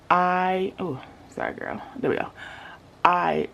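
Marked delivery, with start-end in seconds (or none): none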